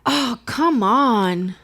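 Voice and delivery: very slow, drawn-out whining; imitating a kid groaning about it; whiny complaint. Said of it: "and he's like".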